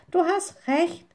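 high-pitched exclamation; high, emphatic character voice; calling out. A narrator's reading. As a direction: call it out as the little witch. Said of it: "rief sie".

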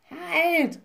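high, drawn-out raven call; raven interjecting. A narrator's reading. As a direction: cut in as the alarmed raven. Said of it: "rief Abraxas dazwischen". (dazwischenrufende Rabenstimme, hoch)

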